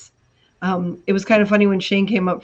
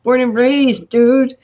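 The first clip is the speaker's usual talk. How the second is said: loud, higher mock insistence; retold exasperated insistence; 5 semitones higher, 7 dB louder, much slower than usual. Retelling arguments with disbelieving classmates, loud exasperated insistence. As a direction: act out insisting on your roots. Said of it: "pulling out my passport and going".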